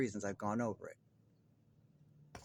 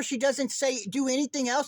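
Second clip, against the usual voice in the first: normal talking voice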